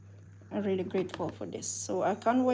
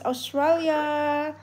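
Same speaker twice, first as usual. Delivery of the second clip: loud, high, drawn-out holiday shout; festive cheer; 7 semitones higher, 6 dB louder, much slower than usual. Real, not acted. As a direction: stretch it out like a celebrating crowd.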